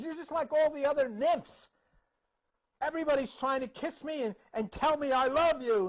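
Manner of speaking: high, loud, fed-up rebuke; acted exasperation. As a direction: scold an unwanted admirer, fed up. Narcissus scolds Echo while shaking her.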